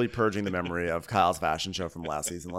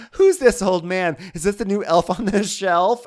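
mock puzzled sister; 10 semitones higher, 8 dB louder than usual. Imitating Kathy asking about the Bernie meme. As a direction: ask it in innocent confusion. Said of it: "she goes".

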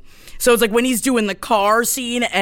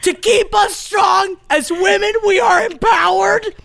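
mock preaching; 6 semitones higher than usual. Mock empowerment sermon.

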